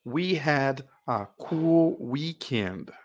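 "We had a cool weekend" drawn out word by word; each word slow and separate; very slow model reading. Shown as a demonstration. Teacher repeating the sentence very slowly before asking its meaning. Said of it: "E o que quer dizer isso?".

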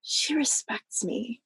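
soft, slow, moved; hushed realization. Retold or imitated. Daughter realizing mom respects her, hushed and slow. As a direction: let it land slowly and softly. Moving childhood realization, retold.

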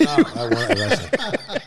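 laughing. Real, not acted.

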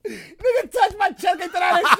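hammed-up cop voice; mock cop; imitating the cop. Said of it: "he said".

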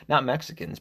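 normal talking voice

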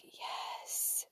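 soft, contented whispered yes; a tiny satisfied whisper. Retold or imitated.

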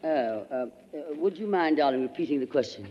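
normal talking voice

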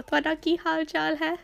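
fast native Punjabi phrasing; Punjabi pronunciation. Shown as a demonstration. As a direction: rattle it off in Punjabi. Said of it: "in Punjabi".

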